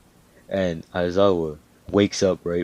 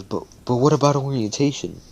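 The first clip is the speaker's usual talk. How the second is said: higher-pitched, stammering timid question; nervous; 6 semitones higher than usual. Retold or imitated. Uraraka asking the teacher about orientation, nervously. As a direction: imitate a hesitant student, stumbling into the question. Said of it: "kinda nervously though".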